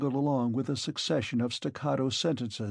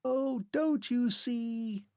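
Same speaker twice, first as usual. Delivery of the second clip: much higher, slow and thin; faint insistent voice; 12 semitones higher, slower than usual. A narrator's reading. Fading Clockwork Man to Arthur, faint and high. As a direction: insist from far away. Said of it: "the faint voice asseverated".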